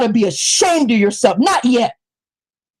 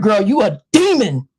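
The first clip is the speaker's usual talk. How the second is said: ordinary delivery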